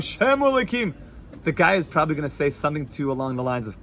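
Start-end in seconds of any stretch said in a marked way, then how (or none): 0.0-0.9 mock scream. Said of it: "the guy is screaming".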